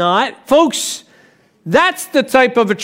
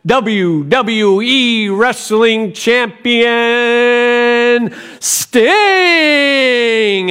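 announcer voice; 2 semitones higher, much slower than usual. Retold or imitated. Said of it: "the announcer voice".

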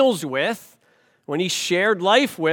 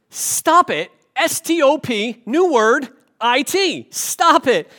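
hammed-up shout, much higher and louder, spelling it out; mock shouting; 7 semitones higher, 6 dB louder, slower than usual. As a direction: yell each letter like an exasperated counselor. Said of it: "He starts yelling at her".

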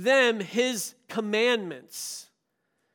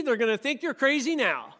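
ordinary talk

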